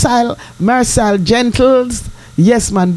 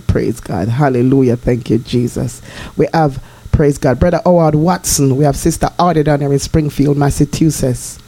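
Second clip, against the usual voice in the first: lower, solemn scripture reading; prayerful reading; 6 semitones lower than usual. Acted. To her listeners, blessing them in a solemn prayerful voice. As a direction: read the psalm and bless them reverently.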